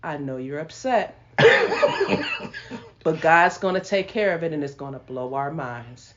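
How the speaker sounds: lightly voiced calm husband; calm and smiling. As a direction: settle her down gently. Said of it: "with a smile on his face".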